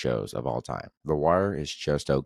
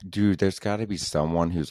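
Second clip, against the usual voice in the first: ordinary delivery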